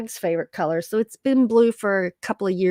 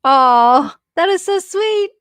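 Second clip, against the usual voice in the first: high, gushing aww to the chat; touched aw; 9 semitones higher, 6 dB louder than usual. Real, not acted. To viewers in chat, a high touched aww. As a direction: melt at a sweet comment, voice up high.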